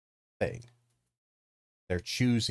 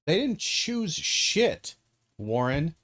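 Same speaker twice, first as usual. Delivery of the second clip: usual speaking voice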